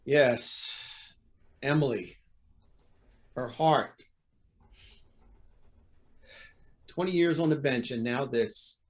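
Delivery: subdued, halting resignation; defeated. Acted. Judge thinking of his ailing wife, defeated. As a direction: let the fight drain out, pausing between thoughts.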